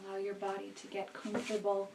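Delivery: soft, hushed meditation voice with long pauses; guided meditation. Acted. Meditation leader settling listeners into breath awareness, softly guiding. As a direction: guide them quietly into the breath, unhurried.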